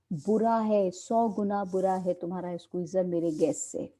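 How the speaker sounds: normal talking voice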